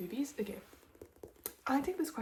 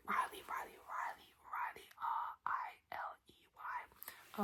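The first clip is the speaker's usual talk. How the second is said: soft, breath-only ASMR whisper; whispered name repetition; 6 dB quieter, slower than usual. Shown as a demonstration. ASMR host lingering on a viewer's name, whispered. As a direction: whisper each name, then spell it slowly. Repeats and spells name as trigger.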